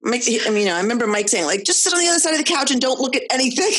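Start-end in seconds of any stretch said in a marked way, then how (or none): 1.6-3.7 fast, laughing instruction; laughing quote. Retold or imitated. Retelling husband's order to the kids, fast and laughing. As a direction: rattle it off through laughter.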